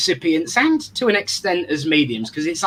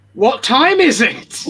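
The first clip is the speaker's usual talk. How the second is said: louder, drawn-out, higher call to the audience; catchphrase call-out; 6 semitones higher, 6 dB louder, slower than usual. Pretend. Host hypes up the next segment, drawn-out catchphrase call. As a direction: call out the catchphrase slowly and big. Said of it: "as I'm about to say".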